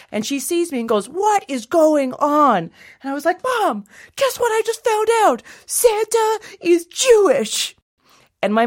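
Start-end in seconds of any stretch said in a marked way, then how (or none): 1.1-2.7 high, slow, emphatic demand; mock a mother demanding an explanation, drawn out; alarmed mother. Mother confronts fleeing child. 3.4-7.8 put-on very high kid voice, big reveal; excited child. Child breathlessly reports discovery.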